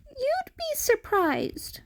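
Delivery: plain reading voice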